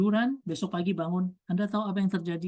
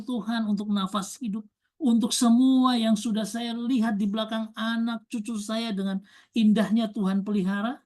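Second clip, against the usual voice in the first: higher and louder, pressing the questions home; fiery preaching; 4 semitones higher, 5 dB louder than usual. Acted. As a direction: preach it hard to the congregation. Preaching at full force. (khotbah berapi-api, lebih tinggi dan keras)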